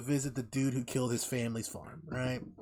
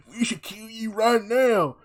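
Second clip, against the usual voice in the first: high, loud, slow mock rage; mock hillbilly yell; 10 semitones higher, 10 dB louder, slower than usual. Hillbilly dad yelling at Bob Lee at his door, mock high shout.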